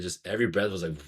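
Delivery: ordinary delivery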